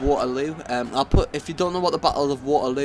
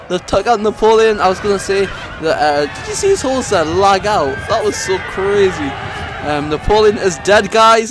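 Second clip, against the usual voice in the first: louder and higher, yelling in disbelief and triumph; excited shouting; 7 semitones higher, 8 dB louder than usual. Real, not acted. Commentator to viewers as Napoleon falls, real excited yelling. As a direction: shout it like your side just won.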